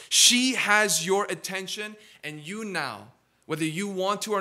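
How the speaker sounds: loud and high, slow, weighty preacher lines; booming fervent sermon; preaching at full force. Acted. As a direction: press the vows on the young men.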